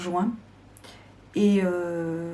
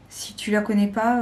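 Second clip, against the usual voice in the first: ordinary talk, nothing marked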